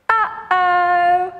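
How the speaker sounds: lightly worried sing-song uh-oh; a playful worried uh-oh. Shown as a demonstration. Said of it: "Can you do an uh-oh?".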